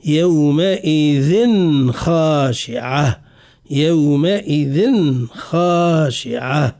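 يومئذ slowly, final n distinct before خاشعة; deliberate recitation, unmerged n in يومئذ خاشعة; clear tanween. Shown as a demonstration. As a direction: sound the n ending of يومئذ clearly, then long خاشعة. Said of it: "نتعمد ونتكلف في إظهار التنوين".

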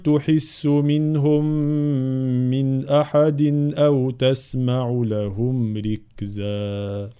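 slow, drawn-out tajweed chant; Quran recitation. Acted. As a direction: recite each word slowly and melodically. (تلاوة مجودة بطيئة)

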